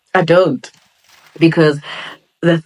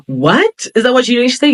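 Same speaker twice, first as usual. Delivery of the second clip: shocked gasp; 9 semitones higher than usual. Retold or imitated. Retelling her gasp at a friend's written goals, mock-shocked. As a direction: recoil in disbelief at what she hears.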